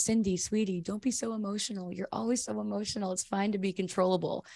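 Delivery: hushed, sweet-talking condescension; mock condescending prince. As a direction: talk down to her gently like a smug prince. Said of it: "the prince goes".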